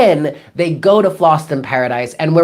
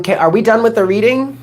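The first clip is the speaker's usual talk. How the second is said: imitated viewer's question; 6 semitones higher than usual. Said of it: "that are like".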